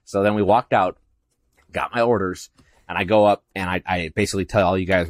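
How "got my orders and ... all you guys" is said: ordinary delivery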